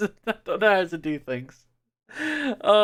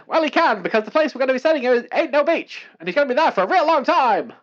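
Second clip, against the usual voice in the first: bright, high news anchor read; newsreader voice; 8 semitones higher, 4 dB louder than usual. Acted. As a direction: read it like an old newsreel announcer. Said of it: "This is my newsreader voice".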